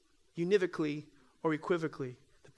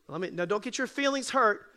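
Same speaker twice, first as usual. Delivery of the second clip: ordinary talk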